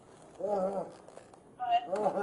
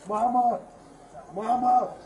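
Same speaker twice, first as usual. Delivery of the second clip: loud desperate calls for mama; desperate calling; 3 semitones higher, 5 dB louder than usual. Real, not acted. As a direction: call out in real despair. Man pinned down calling for mother.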